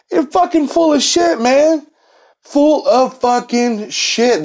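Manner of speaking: high, scornful call-out, slowing at the end; incredulous scorn. Real, not acted. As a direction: call out the lie in disbelief. Calling out the liar.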